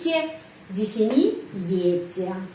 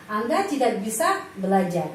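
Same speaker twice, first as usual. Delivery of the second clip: usual speaking voice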